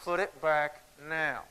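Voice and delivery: slow, tight words through a clenched jaw; a hint of a mom's stern teeth talking. Mom hissing at her daughter in the aisle, slow and through the teeth. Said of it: "clenches her teeth and says".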